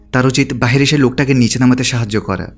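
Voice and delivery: usual speaking voice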